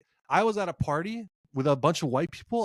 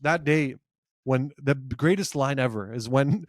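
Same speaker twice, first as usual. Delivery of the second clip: usual speaking voice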